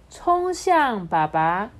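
ordinary delivery

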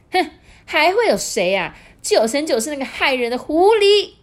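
high, loud sneer; old woman jeering loudly; scornful old wife. Acted. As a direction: spit out the blame.

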